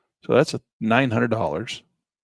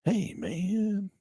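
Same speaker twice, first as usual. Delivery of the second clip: a hint of a laid-back hippie; drawled hippie greeting; stoner voice; 9 semitones higher, 4 dB quieter than usual. Shown as a demonstration. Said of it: "you gotta talk like that".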